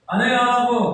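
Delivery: loud, high voice of God; voicing God. God questioning Moses, loud and high. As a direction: call out God's question. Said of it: "Ano sabi ng Diyos kay Moses?".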